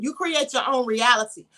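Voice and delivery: usual speaking voice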